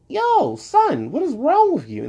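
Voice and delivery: very high, stunned yo son; shocked squeal of disbelief; incredulous outburst. Real, not acted. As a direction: cry out in disbelief at the drawing. Outraged reaction to Batman art.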